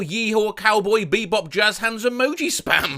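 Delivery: normal talking voice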